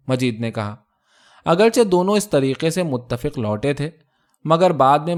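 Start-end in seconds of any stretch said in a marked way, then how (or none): none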